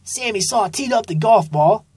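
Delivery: ordinary delivery, nothing marked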